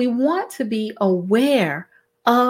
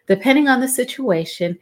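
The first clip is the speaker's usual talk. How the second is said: usual speaking voice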